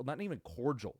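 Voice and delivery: normal talking voice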